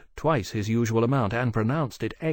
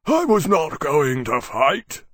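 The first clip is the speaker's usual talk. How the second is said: loud, slow, raised-voice denial; Maximus, unconvincing; 5 semitones higher, 6 dB louder, slower than usual. A narrator's reading. Maximus denying to Temeraire, unconvincingly. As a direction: protest innocence too heavily.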